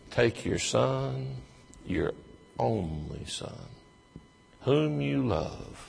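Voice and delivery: each phrase slow and weighty; slow solemn emphasis. Shown as a demonstration. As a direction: let every word land slowly. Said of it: "That's what he actually says".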